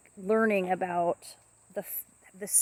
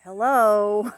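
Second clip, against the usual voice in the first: loud drawn-out hello at usual pitch; imitating pet bird; 10 dB louder than usual. Owner showing her bird's first hello, loud imitation. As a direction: hold out a loud, level bird greeting. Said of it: "she goes".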